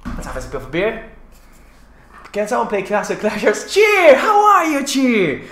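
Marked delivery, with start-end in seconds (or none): 3.7-5.4 high, loud delighted call; excited greeting. Real, not acted. Host spotting a friend in chat, excited greeting. Calls out greeting to viewer Cheer.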